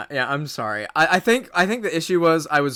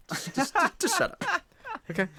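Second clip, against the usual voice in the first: higher squeaky mock snap; mock shut up; 5 dB quieter than usual. Mock telling friend off.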